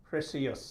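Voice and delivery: "precious" as "pre-see-us"; three syllables in "precious", with "see-us" at the end; common mispronunciation. Shown as a demonstration. Tutor showing how it's often mispronounced, mispronounced. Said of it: "it often mispronounced as".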